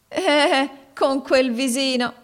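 high, slow mocking tease; mock her pretty face; ironic. A narrator's reading. Maid teasing the girl about her looks, ironic. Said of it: "rispose ironicamente la donna".

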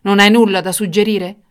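loud impatient demand; impatient king's sharp question. Acted. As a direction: press for an answer, out of patience. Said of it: "esclamò il re impazientito".

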